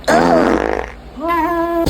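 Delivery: normal talking voice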